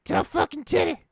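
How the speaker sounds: a cocky, squeaky badass persona; high put-on Jay Darkay voice. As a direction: strut it out as his edgy alter ego. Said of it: "I can do voices so well".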